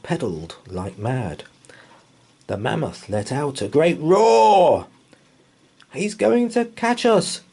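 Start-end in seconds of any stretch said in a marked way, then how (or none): 5.9-7.4 high, breathless scared cry; panting in fear. Acted. Boy pedals from the mammoth, panting in fear. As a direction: gasp it out while fleeing. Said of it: "George panted in fear".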